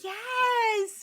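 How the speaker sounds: high, drawn-out celebratory yes; excited cheer. Real, not acted. Friend hearing of top one percent news, cheering.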